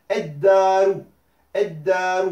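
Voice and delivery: "eddaru" with a long held d; doubled dal. Shown as a demonstration. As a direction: go straight to the doubled d. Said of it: "ed directement".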